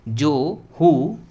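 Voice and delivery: "जो", pause, "हु", loud and slow; careful pronunciation demo. Shown as a demonstration. Teacher translating word by word for students, loud careful demo. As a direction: project "जो हु" slowly, each word apart. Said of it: "इसको कैसे प्रोनाउंसिएट करेंगे पूरा अच्छे से".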